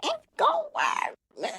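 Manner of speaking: high, laughing through words. Real, not acted. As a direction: squeal it out laughing. Repeating the surfer line, laughing.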